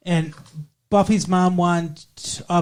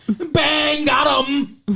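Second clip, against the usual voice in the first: triumphant shout; 9 semitones higher, much slower than usual. Real, not acted. Punchline shout.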